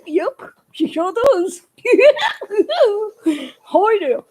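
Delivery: lightly voiced giggly puppet; a hint of a chuckling puppet character. Puppet Billy Bob chuckles to the viewers, lightly voiced.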